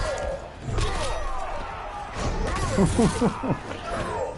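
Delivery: breathy giggle rising up; high wheezy laugh. Real, not acted. Gamer giggling after telling opponent he sucks. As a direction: snicker high and airless.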